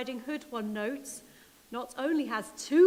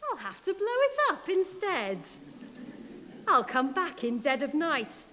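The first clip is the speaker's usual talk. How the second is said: gleeful threat of a bigger plan; scheming wolf; 2 semitones higher, 4 dB louder than usual. Acted. Wolf vowing to blow up the house, louder. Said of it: "Wolf said".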